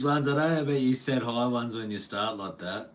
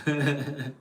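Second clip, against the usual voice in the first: brief bubbling chuckle; quick little run of giggles; real laugh; 3 semitones higher than usual. Real, not acted. Streamer joking with viewers, short chuckle.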